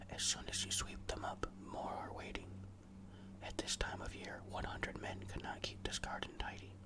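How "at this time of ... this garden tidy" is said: ordinary delivery, nothing marked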